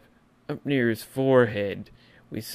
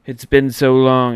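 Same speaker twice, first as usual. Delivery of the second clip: joyous outburst; 2 semitones higher, 13 dB louder than usual. Acted. Segment host to fans, loud joyful relief. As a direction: cry out that the wait was so long. Fan overcome with joy.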